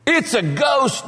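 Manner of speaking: high, drawn-out cry of alarm; terrified cry. Acted. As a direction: cry out as if seeing a ghost. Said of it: "they were terrified".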